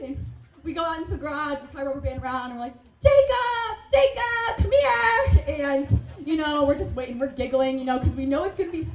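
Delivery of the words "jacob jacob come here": high, loud call to little brother. Retold or imitated. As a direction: lure him in with a sing-song call. Calling brother to the trap.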